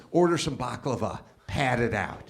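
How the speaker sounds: low, soft deadpan tip; discreet advice. Retold or imitated. Woman behind him in line advising customer, low and discreet. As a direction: slip the advice in quietly from behind.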